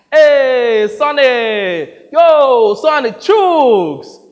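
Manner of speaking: loud, high hail across a room; calling out. Acted. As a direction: shout it out like greeting a buddy. Calling out the new name.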